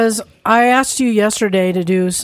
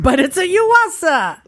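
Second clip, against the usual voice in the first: high, slow, indignant "but"; indignant protest; 9 semitones higher than usual. Retold or imitated. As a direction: act outraged at the brand.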